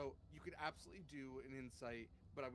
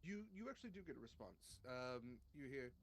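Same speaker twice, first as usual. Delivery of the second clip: ordinary talk, nothing marked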